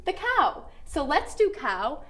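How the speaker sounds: usual speaking voice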